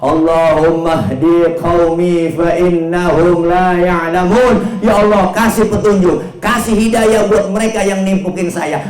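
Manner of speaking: high, drawn-out supplication; impassioned prayer. Acted. Prophet praying guidance for those who stoned him, fervent supplication. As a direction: plead to God in a ringing voice.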